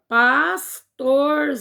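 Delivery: PASTORZINHO drawn out slowly, syllable by syllable; separated into syllables. Shown as a demonstration. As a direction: say "pastorzinho" slowly in four beats, pas-tor-zi-nho. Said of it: "Separa essa palavra". ("pastorzinho" bem devagar, sílaba por sílaba)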